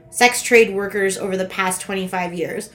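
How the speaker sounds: normal talking voice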